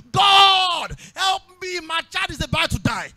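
high, loud plea with a long-drawn "God"; desperate cry to God. Acted. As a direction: cry out as a mother pleading for her child. Acts out the mother crying to God.

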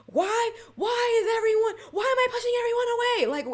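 high, loud hammed-up lament; mock anguished wail. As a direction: wail the question in comic despair. Acting out her own exasperated questioning.

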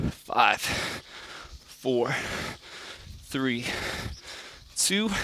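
quiet, strained counting mid push-up; counting workout reps. Real, not acted. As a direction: count reps under your breath while working. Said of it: "Starting to feel it a little bit".

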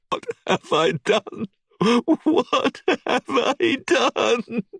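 high, slow anguished wail; horrified. A narrator's reading. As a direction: wail it in horror. Jacqueline after shooting Simon.

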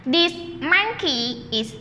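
slow, spaced read for the class; slow choral read. Shown as a demonstration. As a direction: read it slowly for everyone to join. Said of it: "Read the sentence together".

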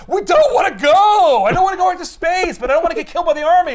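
high, loud comic complaint; mock protesting wail. Turtles refusing another trip into space, loud mock protest. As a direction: wail it like a reluctant hero.

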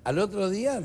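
ordinary delivery

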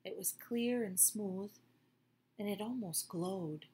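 ordinary delivery, nothing marked

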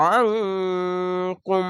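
আনকুম with a thick আইন squeezed in the throat, nasal hold on ন; heavy throaty ain. Shown as a demonstration. Teacher demonstrating ikhfa and ain to students. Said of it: "আইনটিকে মোটা করে গলা চিপে পড়বেন".

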